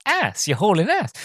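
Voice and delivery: a hint of high-pitched character mimicry; Enrico. Naive passenger repeating the phrase, lightly imitated high voice. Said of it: "Rowan Atkinson's character's like".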